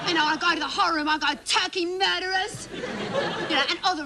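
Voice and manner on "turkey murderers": high, drawn-out accusing cry; hammed-up horrified accusation; mock accusation. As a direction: denounce them slowly, pitched up. Child accuses the family at table.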